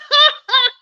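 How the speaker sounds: a bright burst of laughter; rueful little laugh; short high laugh. Real, not acted. Laughs at her own stubbornness.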